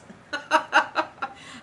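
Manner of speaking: an airless little giggle. Real, not acted. Teacher laughs at a panicking viewer.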